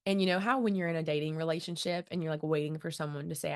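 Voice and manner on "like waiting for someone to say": usual speaking voice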